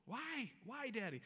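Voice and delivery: put-on high-pitched little kid protesting; frustrated child. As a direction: plead upward like a frustrated daughter. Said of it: "come to me very frustrated".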